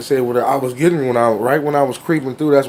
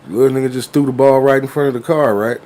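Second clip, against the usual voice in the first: ordinary delivery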